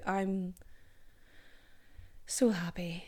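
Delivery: whispered so happy that lands sad; mean it, but it comes out forlorn; flat sad whisper. Real, not acted. Host trails off into a whisper the listeners hear as sad. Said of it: "That sounded very sad".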